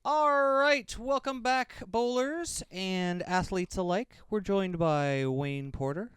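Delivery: loud announcer delivery with drawn-out words; high opening, stretched out and loud; show host welcome. Acted. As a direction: welcome the audience back big and slow. Welcomes viewers back.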